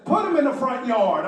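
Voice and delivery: lightly voiced wife, laughing; deadpan wife order, half-laughing. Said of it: "She says".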